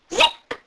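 high-pitched quick arrow zip; short, high sound effect of an arrow. A narrator's reading. Narrator imitates flying arrow.

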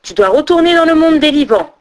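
high, loud, insistent order; commanding. A narrator's reading. Unseen voice sending Odin back to the living, commanding. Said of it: "ordonna encore la voix".

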